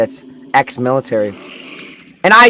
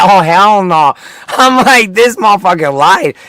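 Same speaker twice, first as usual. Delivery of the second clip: indignant outburst; 5 semitones higher, 5 dB louder than usual. Retold or imitated. Acts out outrage at the lie.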